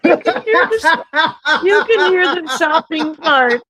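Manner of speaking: high, slow words through laughter; laughing. Real, not acted. Cohost laughing at the cart noise in the video, squeaky laughing. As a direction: laugh while pointing out the cart.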